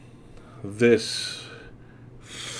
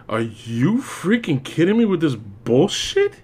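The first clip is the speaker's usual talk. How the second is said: slow, weary incredulity; drawn-out disbelief; 4 semitones higher than usual. Retold or imitated. Reviewer fed up with the armored Batman, slow and weary. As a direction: drag out each word, fed up. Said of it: "But I'm just there like this, like".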